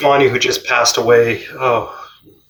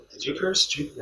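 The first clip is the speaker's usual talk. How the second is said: much softer, nervous asking; hushed question; 4 semitones higher, 12 dB quieter than usual. Retold or imitated. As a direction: whisper it aside to a friend. Asking friends in the gym.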